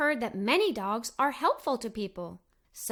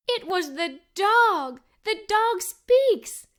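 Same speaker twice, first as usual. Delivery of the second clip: higher, louder, slow with wonder; louder, slow, openly astonished; amazed; 6 semitones higher, 4 dB louder, slower than usual. A narrator's reading. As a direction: savour the amazing discovery. Narrator amazed dog talks.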